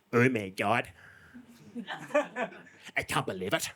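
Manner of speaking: a hint of a gossiping Irish lady nudging her husband; mock Irish lady murmuring to Michael; thick Irish accent. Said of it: "they had a very thick Irish accent".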